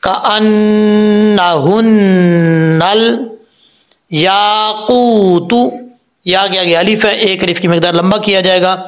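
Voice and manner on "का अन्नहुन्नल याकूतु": slow, drawn-out tajweed chant; Quranic recitation. Shown as a demonstration. Teacher reciting the verse for students, slow chant. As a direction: recite slowly, stretching each vowel. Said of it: "ऐसा नहीं करना".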